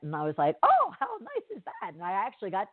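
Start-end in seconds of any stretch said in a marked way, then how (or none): 0.6-1.9 high, gushing mock-delight; delighted surprise. Customer hearing of an extra shipment, pleasantly surprised. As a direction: react with a swooping, very high lilt. Reacting to news of extra package.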